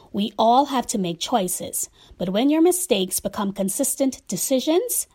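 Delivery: normal talking voice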